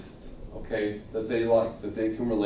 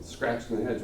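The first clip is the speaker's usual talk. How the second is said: ordinary talk, nothing marked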